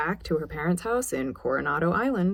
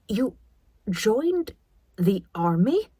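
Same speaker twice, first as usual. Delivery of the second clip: slow, each word sounded out; mother slowly taking in the news; slow disbelief; 4 semitones higher, 3 dB louder, slower than usual. A narrator's reading. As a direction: sound out the words as if they were foreign. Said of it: "Mom said slowly".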